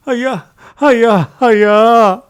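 high, loud wailing; grief-stricken repeated cry. A narrator's reading. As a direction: lament loudly over the dead master. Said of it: "అని ఏడుస్తూ".

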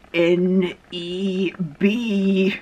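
very slow, letter by letter; painstaking spelling. Pretend. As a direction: draw out each letter while copying it. Acting him spelling from the Bible.

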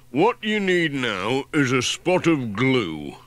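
a practical handyman with a plan; Ted the handyman. Acted. Ted offers glue to fix the letter, slow helpful workman voice.

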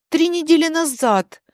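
ordinary delivery, nothing marked

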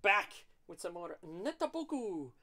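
loud, high upbeat hello; big energetic YouTube welcome; excited greeting. Real, not acted. Channel intro greeting.

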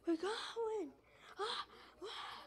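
high gasping alarm; panicked yelp as it spins; startled panic. Real, not acted. Helicopter spinning out.

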